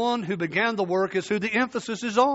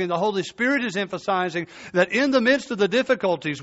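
normal talking voice

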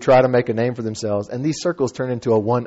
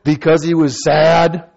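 slow, deliberate, simple reply; deadpan slow answer; slow plain answer; 3 semitones higher, 6 dB louder, slower than usual. Retold or imitated. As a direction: state it slowly like it's obvious. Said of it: "And I went".

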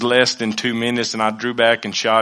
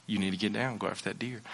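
much softer, low, calm command; God's inner voice; 3 semitones lower, 15 dB quieter, faster than usual. Retold or imitated. God speaking to the hunter in his spirit, soft and low. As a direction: hush it like a voice inside your head. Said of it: "And the Lord went".

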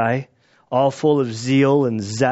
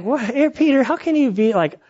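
incredulous disbelief; 10 semitones higher than usual. Retold or imitated. Disciples incredulous at Peter.

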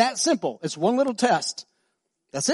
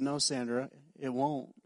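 gentle; 7 semitones lower, 13 dB quieter than usual. Retold or imitated. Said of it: "I said".

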